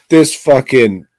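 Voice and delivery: usual speaking voice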